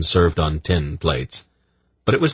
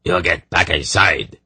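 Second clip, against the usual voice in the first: barked order. A narrator's reading. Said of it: "the man barked".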